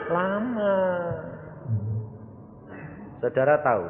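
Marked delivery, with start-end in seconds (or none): 0.1-0.8 matter-of-fact answer. Acted. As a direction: answer without any shock. Answer to the loud question. (jawaban santai tanpa kaget)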